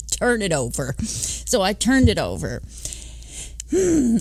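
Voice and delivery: ordinary delivery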